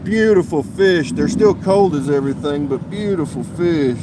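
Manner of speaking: loud, drawn-out admiration; proudly exclaim, stretching it out slowly; admiring excitement. Real, not acted. Angler shows off a beautiful fish, loudly admiring.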